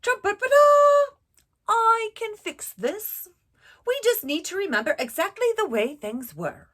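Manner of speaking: loud, high trumpeting elephant, sure of itself. Acted. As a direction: blast it like a bossy elephant with a plan. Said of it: "The elephant knew just what to do".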